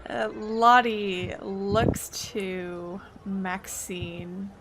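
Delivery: normal talking voice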